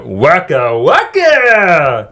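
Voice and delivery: slow, stretched Waka Waka; goofy puppet bear voice; Fozzie Bear impression. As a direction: drag out the bear's catchphrase. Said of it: "So you're exclusively Muppets".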